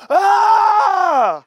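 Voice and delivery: a loud, high open-mouthed "Ah!"; release shout. Shown as a demonstration. Preacher urging the crowd to shout it out. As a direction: scream it out of the chest. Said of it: "Open up your mouth and just go".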